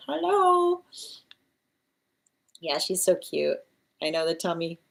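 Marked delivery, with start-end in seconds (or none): 0.1-0.8 very high cat-like hello; imitating a cat. Owner mimicking her cat's hello, high chirp. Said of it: "but she's like".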